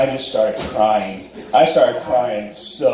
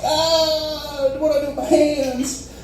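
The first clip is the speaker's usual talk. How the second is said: high-pitched comic whimper; mock panic; 13 semitones higher, slower than usual. He is clueless while making out, high whimpering mock panic. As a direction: wail it helplessly in a high voice.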